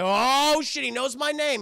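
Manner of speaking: loud, high startled outburst; mock alarm. Cop hearing the villain say his name, mock alarmed. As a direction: blurt it out in exaggerated shock.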